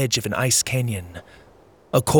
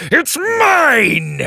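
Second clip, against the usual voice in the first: higher, louder crowing shout; gleeful triumph; 13 semitones higher, 6 dB louder than usual. Acted. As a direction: hold the prize up and crow. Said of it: "Drake laughed".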